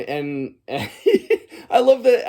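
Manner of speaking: ordinary delivery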